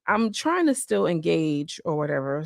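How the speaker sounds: ordinary talk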